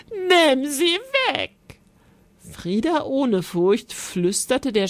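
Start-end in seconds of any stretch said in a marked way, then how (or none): 0.1-1.5 frightened scream. A narrator's reading. Giantess to the knight about the spider, screaming in fright. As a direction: cry out in terror of a spider. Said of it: "schrie sie".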